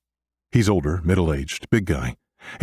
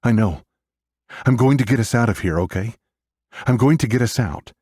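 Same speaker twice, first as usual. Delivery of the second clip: raised, insistent big-brother promise; urgent reassurance; 6 semitones higher, 3 dB louder than usual. A narrator's reading. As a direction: comfort her urgently, pitched higher. Brother urgently reassures wailing sister.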